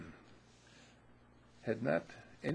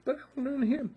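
put-on much higher, louder childish whine; student insisting to the music school, high whiny plea; whining student; 6 dB louder, much faster than usual.

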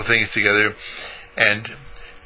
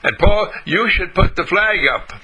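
put-on lightly raised eager children's voice; kids cheering; 7 semitones higher than usual. The group of kids praising Paul, lightly childlike cheer. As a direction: cheer him on like a happy five-year-old.

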